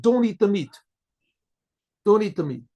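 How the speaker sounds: butcher's warning, half called out; urgent warning. Retold or imitated. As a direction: warn them off, mildly urgent.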